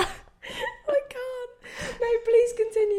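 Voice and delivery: high, breaking laugh-cry; can barely talk from laughing; laughing to tears. Real, not acted. Laughing till crying.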